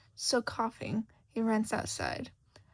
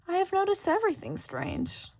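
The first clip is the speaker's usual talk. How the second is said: higher, louder sly cat voice; Cheshire Cat; 10 semitones higher, 4 dB louder than usual. Acted. Cheshire Cat answering lost Shota, sly and amused. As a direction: purr it like a teasing cat.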